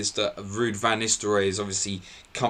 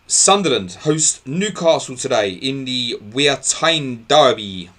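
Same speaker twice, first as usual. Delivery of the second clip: slow, louder, hammed-up northern English accent; put-on regional accent; 4 semitones higher, 7 dB louder, slower than usual. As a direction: announce the derby in a mock local northern English accent. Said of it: "I don't know what that accent was all about".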